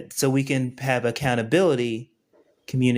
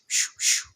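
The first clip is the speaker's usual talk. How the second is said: whispered shoo. Pretend. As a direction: breathe it out like sneaking something in. Sneaking rune in, whispered shoo.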